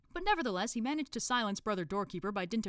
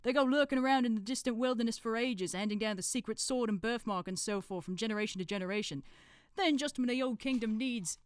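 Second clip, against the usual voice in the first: know-it-all in a put-on accent, slightly raised; Brother Watchtower; 5 semitones higher, 4 dB louder than usual. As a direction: lecture like a self-taught expert, accent put on. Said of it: "decided to do an accent".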